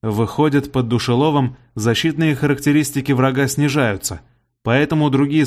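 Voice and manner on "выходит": ordinary delivery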